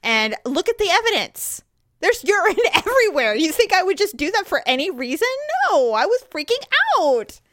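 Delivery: mock-indignant self-defence; indignant retort. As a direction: argue your case, affronted. Arguing back in retold quarrel.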